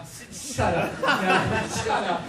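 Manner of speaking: embarrassed, playful brush-off through laughter; laughing protest. Real, not acted. Actor to teasing friend calling them big deal, laughing.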